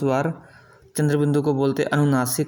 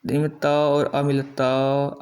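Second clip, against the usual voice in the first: slow demo; much slower than usual. Shown as a demonstration. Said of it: "अ की sound आ रही है".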